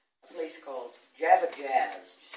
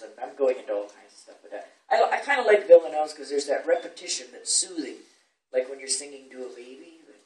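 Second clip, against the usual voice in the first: ordinary talk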